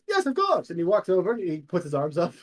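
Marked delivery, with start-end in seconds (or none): 0.1-0.7 very high, hearty assent; soaring, eager hero voice; Demos agreeing. Acted. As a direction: agree with theatrical gusto. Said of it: "he goes".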